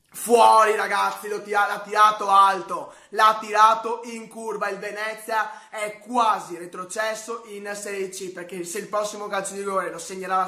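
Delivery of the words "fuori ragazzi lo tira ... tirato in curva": loud call of a missed penalty; excited commentary. Real, not acted. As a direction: shout it as the ball flies over. Live miss in shootout.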